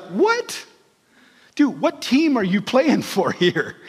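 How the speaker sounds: playfully floored by Peter; mock incredulous Jesus. Jesus rebuking Peter, mock incredulous.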